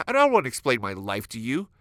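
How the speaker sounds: hammed-up irritated rant at the nosy friend; aggressive outburst. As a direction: snap back at the friend, mock angry. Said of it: "you get aggressive again".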